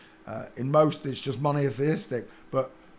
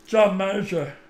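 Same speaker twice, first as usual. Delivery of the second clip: lightly voiced John Major; name yourself in his voice; mock politician voice; a hint of John Major; 5 semitones higher, 5 dB louder than usual. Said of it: "who was we gonna do".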